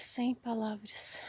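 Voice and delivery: slow breathy whisper, words failing; speechless whisper. Real, not acted. Showing viewers her ruined planters, a stunned whisper.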